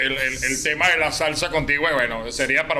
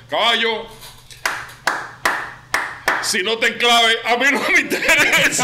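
imitating a friend; 6 semitones higher than usual. Host quoting what a musician told him about music. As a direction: lightly mimic his dismissive answer. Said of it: "y me dijo".

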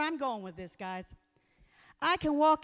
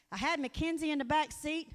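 ordinary talk, nothing marked

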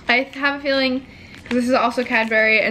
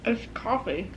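chewing while talking; talking around food; mouth full; 10 dB quieter than usual. Real, not acted. Seen 0: Cap wearer chews after biting wafer; mouth clears by 2.4.

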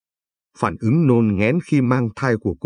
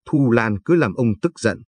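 ordinary delivery

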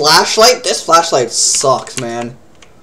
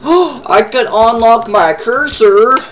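surprised gasp; 6 semitones higher, 3 dB louder, much slower than usual. Real, not acted. Gasps at discovery in game.

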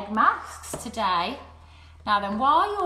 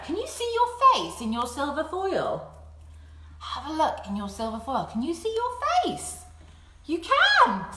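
excited; 6 semitones higher than usual. Acted. Excited face in foil.

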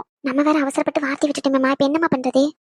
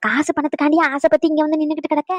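usual speaking voice

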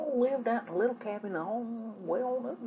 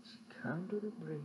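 ordinary delivery

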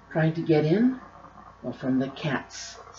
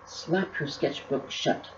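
ordinary delivery

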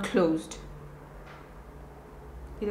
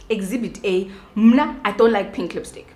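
ordinary talk, nothing marked

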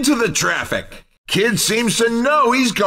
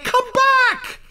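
high-pitched announcer call after a runaway. Pretend. Announcer calling player eight back to the track, calling out.

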